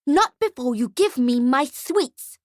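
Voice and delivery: put-on slow, high child voice; slow, insistent high-pitched brat; bratty demand. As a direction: demand it like a spoilt little girl. Girl demands sweets from Santa.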